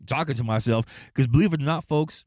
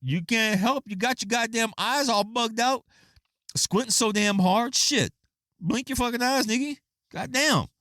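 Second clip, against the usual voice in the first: much higher, exasperated ranting; mock scolding rant; 10 semitones higher than usual. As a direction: rant at her like a fed-up friend. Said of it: "I'm like".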